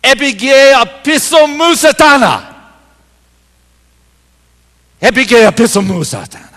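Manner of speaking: "Epige apisomou satana" read out high and loud as Greek. Acted. Quoting Jesus to Peter, "Epige apisomou satana" spoken as Greek. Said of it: "He said it like this".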